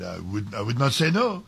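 a made-up French accent, lightly put on; host guessing at a French player's accent in a loose French accent. Host quoting the French player, in a vague invented French accent. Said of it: "I think I'm inventing the accent".

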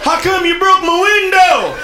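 slow, drawn-out mock outrage; upset car owner. Car owner to the man who broke his window, outraged. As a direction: wail it in comic dismay.

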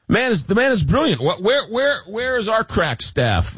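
high-pitched playful exasperation; mock amazement. Host playfully marvels, scolds own staff.

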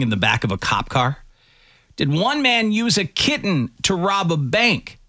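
bright radio host tease, pitched high. Real, not acted. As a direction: punch up the teasers before the break. Host teases segments before break.

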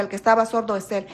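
ordinary delivery

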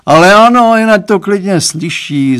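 father loudly insisting his daughter listen; exclaiming. A narrator's reading. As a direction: call it out boldly so all can hear. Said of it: "zvolal pan Stránský".

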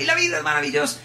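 high, bouncing cry of joy; jumping exclamation. Retold or imitated. Teacher jumping before the class, high joyful cry. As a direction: say it mid-jump, full of joy. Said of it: "y saltaba".

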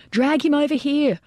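high, urgent holler; hollered order. A narrator's reading. Lieutenant directing men dragging a casualty, high holler. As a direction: holler it with pitch held up. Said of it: "Boland hollered".

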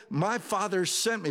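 ordinary talk, nothing marked

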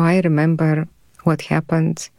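normal talking voice

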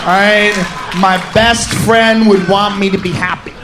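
put-on deep boyfriend voice. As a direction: drop way down and confess like the guy.

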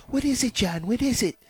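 wife answering her husband's call, mildly weary; mom voice. Retold or imitated. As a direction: answer sweetly, a bit put upon.

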